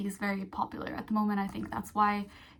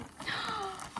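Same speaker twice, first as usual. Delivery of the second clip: sharp, high intake of breath; excited little gasp; delighted gasp; 9 semitones higher, 10 dB quieter than usual. Real, not acted. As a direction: gasp at a lovely surprise. Finds a note from seller.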